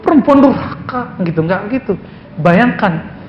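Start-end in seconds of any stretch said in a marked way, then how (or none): 0.0-1.2 mock rebuke. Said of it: "Nggak bilang".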